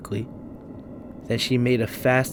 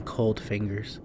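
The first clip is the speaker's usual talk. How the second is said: plain reading voice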